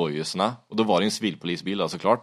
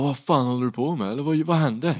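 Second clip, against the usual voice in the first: high-pitched, alarmed confusion; bewildered questioning; 7 semitones higher than usual. Retold or imitated. Man questions rider collapsed on his floor, bewildered. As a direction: stumble upward into a baffled question.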